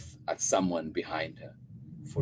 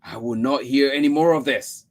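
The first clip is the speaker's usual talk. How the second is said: loud, raised voice of a frustrated mother; mother losing her temper with the child; angry shout; 7 semitones higher, 8 dB louder than usual. A narrator's reading. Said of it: "I shouted".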